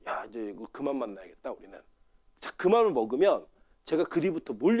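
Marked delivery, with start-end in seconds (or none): 0.1-1.8 low, hushed self-talk; quiet inner resolve. Retold or imitated. Pastor voicing his own private decision to part, hushed. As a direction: say it under your breath, decided. Imagined resolve to quit partnership.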